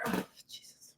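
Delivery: breathless whispered "Oh, Jesus"; exasperated whisper to herself; whispered aside. Real, not acted. Exasperated aside about her mistake.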